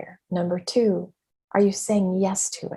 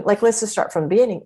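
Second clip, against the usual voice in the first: ordinary talk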